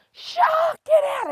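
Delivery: high, loud warning shout; scream. Retold or imitated. Girl screaming at the kids to leave the water, high yell. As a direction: yell it again at the swimmers. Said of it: "She screamed on the top of their lungs".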